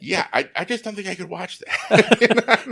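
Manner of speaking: high, laughing disbelief; giggling at the overacted Hamlet just performed. Real, not acted. Reacting to the parody he performed.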